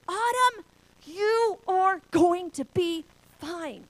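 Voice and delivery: high, loud, very slow calling; yelling down. Retold or imitated. Father calling down to buried daughter, slow yelling. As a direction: shout each word out long and high. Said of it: "he yells over to me".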